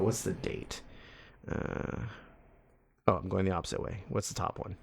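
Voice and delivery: soft, half-whispered reading to self; muttering. Real, not acted. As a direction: read it off the screen under your breath.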